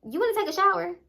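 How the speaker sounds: high, leading offer; sly question. Retold or imitated. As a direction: lift it high, faux-innocent. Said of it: "she was like".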